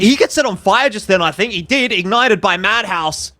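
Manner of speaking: loud, high play-by-play rush; excited esports caster. Real, not acted. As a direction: shout the big play as it lands. Caster reacts to big shot.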